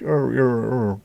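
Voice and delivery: mock posh British drawn vowels; posh accent. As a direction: lightly imitate a very posh English accent. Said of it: "very, very posh".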